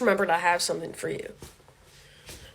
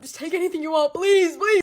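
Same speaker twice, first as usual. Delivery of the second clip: rising panicked begging; mock pleading; 8 semitones higher, 5 dB louder than usual. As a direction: beg like you're being robbed. Pretend robbery surrender.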